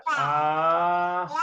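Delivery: "Ahmad" with a long held Ah, then mad; drawn-out Ahmad. Shown as a demonstration. Teacher writes it to read Ahmad, drawn-out pronunciation. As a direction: hold the first syllable of "Ahmad" very long. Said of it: "biar dibaca Ahmad gitu ya".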